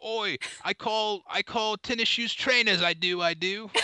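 put-on high, silly British accent. Second go at the British off, high hammed-up British. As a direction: pitch a mock British accent way up. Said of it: "let's have a British off right now".

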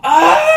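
a sharp high shriek of shock; startled mom shrieks; retold mom's horrified cry; mock gasp and shriek. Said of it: "And she goes".

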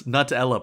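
Nutella said as NUT-ella; first vowel of Nutella as in "nut"; nut pronunciation. Retold or imitated. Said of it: "Who was this Nutella person".

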